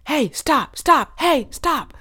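a high-pitched shout from across the store; coworker calling out. Retold or imitated. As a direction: mimic a far-off worker calling hey stop. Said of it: "in the background I hear".

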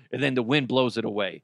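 ordinary talk, nothing marked

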